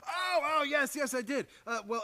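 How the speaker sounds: startled yes-yes; flustered recall. Acted. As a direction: catch yourself and cover. Remembers proposing, flustered.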